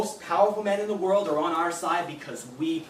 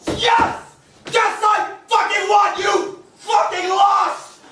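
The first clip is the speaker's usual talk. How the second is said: much higher and louder, slow savage glee; triumphant scream; 12 semitones higher, 10 dB louder, slower than usual. Acted. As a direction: roar in victory. Triumph at her deletion.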